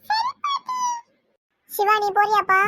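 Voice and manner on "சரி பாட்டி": put-on high, softer child's voice, obedient; a little kid meekly saying okay; small child. Grandchild agreeing to go with grandpa, soft high child voice.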